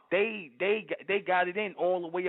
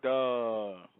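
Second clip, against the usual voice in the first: mock duh; 6 semitones lower than usual. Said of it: "I'm looking at her like".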